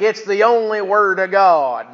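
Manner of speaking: loud, slow zealot's declaration; imitated stubborn true believer; mock dogmatic insistence. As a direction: proclaim it as beyond argument, drawn out. Retold distributor insists on King James.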